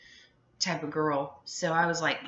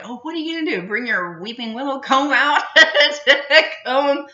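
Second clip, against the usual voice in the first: sassy retort; 8 semitones higher, 10 dB louder than usual. Retold or imitated. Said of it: "I'm like".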